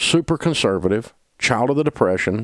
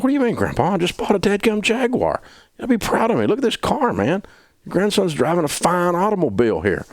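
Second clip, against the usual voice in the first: eager grandson pleading; 10 semitones higher than usual. Retold or imitated. Pleading with grandpa over Jaguar.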